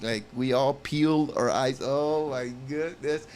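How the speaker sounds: ordinary delivery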